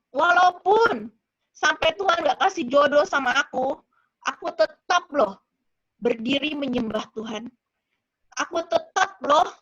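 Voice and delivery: forceful pledge, recounted; emphatic vow to God. Retold or imitated. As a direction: declare it firmly, leaning on the key words. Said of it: "aku bilang gitu sama Tuhan".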